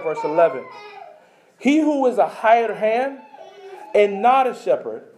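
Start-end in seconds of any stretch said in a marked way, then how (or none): 1.6-5.0 slow, deliberate weighty reading; slow scripture reading. Acted. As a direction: let each phrase of the verse land. Reading scripture slowly, emotionally.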